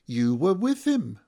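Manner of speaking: loud, high accusation; accusing. A narrator's reading. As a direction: point him out before the whole group. Voice accuses him across the circle.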